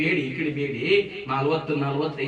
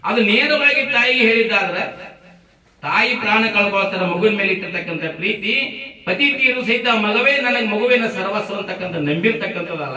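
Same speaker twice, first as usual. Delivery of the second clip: raised, emphatic orator's voice; impassioned preaching; 6 semitones higher, 6 dB louder than usual. A narrator's reading. Preacher tells listeners of a widow living for her son, impassioned. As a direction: preach it high and loud. Preacher builds the mother's plight.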